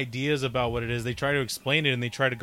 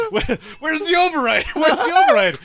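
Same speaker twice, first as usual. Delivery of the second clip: mock panic; 13 semitones higher, 8 dB louder than usual. Crew panics in retold scene.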